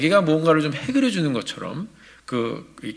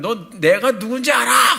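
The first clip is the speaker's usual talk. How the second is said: arrogant challenge; 8 semitones higher, 4 dB louder than usual. Retold or imitated. Young man threatening clerk.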